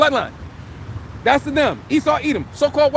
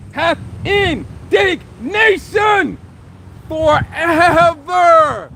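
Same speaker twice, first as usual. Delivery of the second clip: preaching shout; 4 semitones higher, much slower than usual. Acted.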